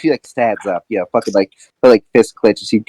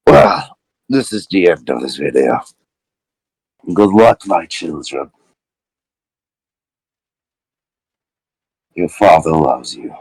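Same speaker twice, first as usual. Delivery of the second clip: father's farewell; 5 semitones lower than usual. Acted.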